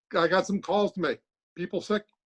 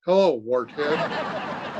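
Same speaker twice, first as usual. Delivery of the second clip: slow insult; 3 semitones lower, much slower than usual. Acted.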